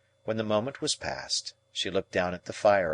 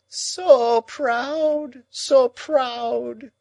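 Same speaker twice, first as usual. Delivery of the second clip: moaning; 6 dB louder, much slower than usual. A narrator's reading. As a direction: moan it twice as a lament. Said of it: "moaned Miss Havisham".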